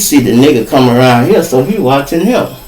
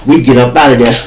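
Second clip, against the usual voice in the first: ordinary talk, nothing marked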